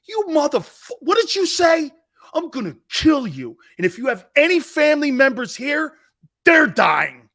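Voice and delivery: high, loud raging threat; furious yell. Retold or imitated. Lineman retold as insulted, furious screamed threat. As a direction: blow up at the lineman. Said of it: "And I went".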